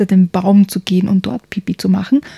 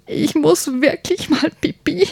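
put-on much higher, pleading child voice; urgent little prince; 8 semitones higher than usual. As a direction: beg like a kid who can't hold it. (dringend flehender kleiner Prinz, viel höher)

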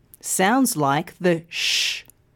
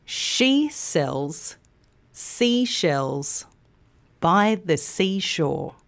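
slow; 2 semitones lower, much slower than usual. Shown as a demonstration. Teacher repeats for learners to join in, slowly. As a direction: take it slowly, pausing after each phrase. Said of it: "start by saying it slowly".

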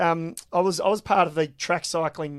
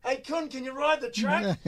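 higher, softer, distant-sounding call; calling across; 6 semitones higher, 8 dB quieter than usual. Retold or imitated. Kempsey turns from the phone to ask his Dutch mate, calling out. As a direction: imitate calling to someone away from the phone.

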